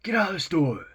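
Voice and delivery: high, loud excited yell; clerk shouting. Retold or imitated. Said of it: "the clerk just lost it".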